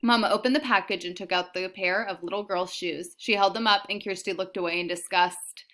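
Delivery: ordinary delivery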